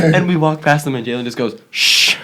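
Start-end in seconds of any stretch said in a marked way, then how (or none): 1.7-2.2 long, sharp "shh"; shushing. Retold or imitated. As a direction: shush a noisy group. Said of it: "He just tells them to shut up".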